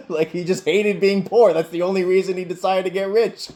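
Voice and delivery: high, loud, helpless amusement; laughing while talking. Real, not acted. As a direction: squeeze the words out through real laughter, pitch way up. Laughing fit over boss's motive.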